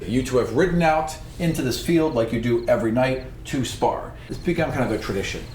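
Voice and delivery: normal talking voice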